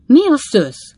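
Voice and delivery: high, loud cry of surprise; startled exclamation. A narrator's reading. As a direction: blurt it out in shock. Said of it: "Kiáltott fel Pickwick úr".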